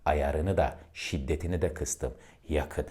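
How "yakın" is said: Yakın said low and soft, close by. Shown as a demonstration. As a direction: pull Yakın in low and quiet. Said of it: "Bakın ses bana doğru biraz yaklaştı".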